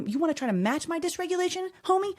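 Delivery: high-pitched mock challenge; confrontational. Dysregulated mom daring her kids, mock confrontational. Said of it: "use foul language and yell".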